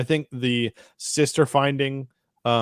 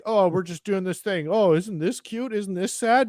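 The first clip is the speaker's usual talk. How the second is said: higher, sing-song mock sweetness; mocking cutesy shows; 7 semitones higher than usual. Mocking cutesy Star Wars shows, higher sing-song sarcasm. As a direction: sneer at cheap sentimental tropes in a higher voice. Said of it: "being like".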